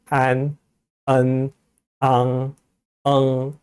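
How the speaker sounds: 안 and 앙 held level with nasal endings; evenly intoned 안, 앙 as a nasal pronunciation demo; nasal finals. Shown as a demonstration. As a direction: let 안 and 앙 ring in the nose. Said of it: "하는 애들이 비음 운모고요".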